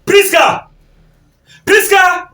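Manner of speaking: high, carrying summons; calling out. Acted. Father summoning his daughter Priska, calling out high. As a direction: call the name to another room.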